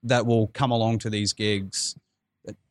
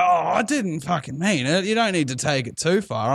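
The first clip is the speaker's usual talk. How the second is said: put-on higher, protesting drunk man's voice; imitated drunk guy; 7 semitones higher than usual. Drunk man answering being called out, higher-pitched mock protest. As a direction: play the drunk brushing it off. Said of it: "this drunk guy? he was like".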